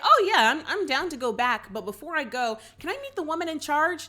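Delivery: eager Barbie. Retold or imitated. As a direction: play a keen Barbie asking to meet the boss. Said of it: "Barbie's just like".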